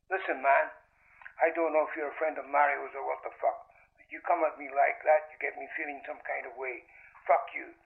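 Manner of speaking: thin telephone-line voice; tinny, needled caller on the phone; phone caller warning. A narrator's reading. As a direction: warn them off down the line, without raising the volume.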